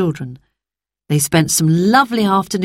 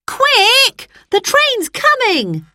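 high, urgent call, slowing on the train; a boy calling his sisters to hurry; excited cry; much slower than usual. Acted. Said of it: "cried Peter".

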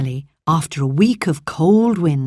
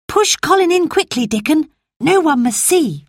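put-on girl Mary; 10 semitones higher than usual. Mary urges Dickon.

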